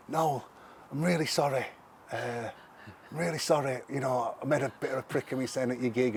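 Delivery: sheepish apology. Retold or imitated. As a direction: play the nervous brother saying sorry, lightly. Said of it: "our kid's gone all right sheepish".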